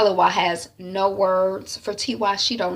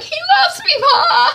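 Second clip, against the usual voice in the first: very high, loud whining plea; desperate wail; 8 dB louder than usual. Retold or imitated. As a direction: wail it to mother, hammed-up. Daughter wails to mother.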